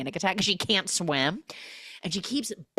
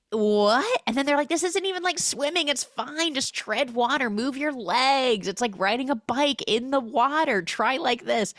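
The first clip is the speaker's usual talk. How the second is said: much higher, cheery instructions; high mock coaching friends; 8 semitones higher than usual. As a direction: talk her through it like a coach. Friends coaching swimmer, voiced high.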